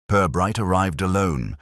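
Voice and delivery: ordinary delivery, nothing marked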